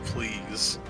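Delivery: ordinary talk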